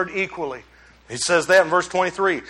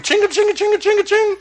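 high mock key jangle, chinga chinga; playful imitated key rattle; jingling keys noise; 11 semitones higher than usual. Jingling keys sound effect.

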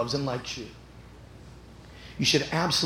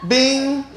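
high bright ping for a gleaming tooth; sparkle sound effect; 11 semitones higher, 9 dB louder than usual. Retold or imitated. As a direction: sing out a tiny ding, pitched high.

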